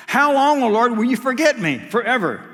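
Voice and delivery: preaching the psalm with rising force; fervent scripture reading. Acted. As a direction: cry the lament out to the congregation. Quotes the psalm again.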